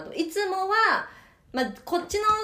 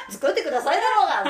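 fast, loud, laughing mock scold; 7 semitones higher, 7 dB louder, faster than usual. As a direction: snap back in playful outrage.

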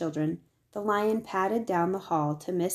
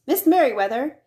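louder, higher urgent call; 7 semitones higher, 8 dB louder than usual. A narrator's reading. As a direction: call down the hall while hurrying. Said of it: "he called as he walked".

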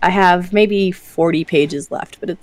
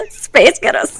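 Scottish accent; 7 semitones higher, slower than usual. Shown as a demonstration. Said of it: "that's how you say Spice Girls with a Scottish accent".

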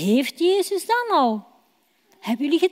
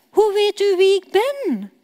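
astonished question, higher and louder; surprised; 7 semitones higher, 4 dB louder than usual. Acted. Nathanael asking Jezus how he knows him, astonished. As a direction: ask in open amazement. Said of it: "vraagt Nathanael verbaasd".

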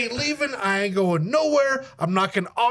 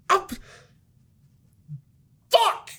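mock panic at waking up dead; shocked outburst; 12 semitones higher, slower than usual. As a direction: blurt it out in alarm.

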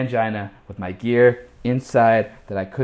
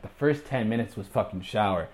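ordinary delivery

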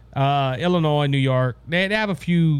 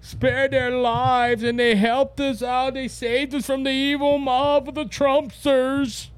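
high, slow, sarcastic impression of a gushing committee member; drawn-out, higher mock praise for the officers; 7 semitones higher, slower than usual. Host mocking the January sixth committee on his show, high slow sarcastic voice. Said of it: "what about January sixth committee? They did".

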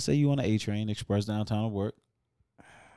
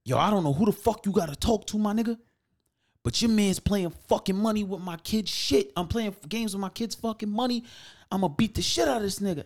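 angry threat; 10 semitones higher than usual. Retold or imitated. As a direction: rant high and heated. Angry customer voiced in story.

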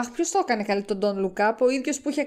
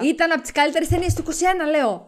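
fast, higher-pitched protest; emphatic insistence; 6 semitones higher, 3 dB louder, faster than usual. Real, not acted. Repeating a claim to a dismissive co-host, insistent. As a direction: dig in and insist, quick and high.

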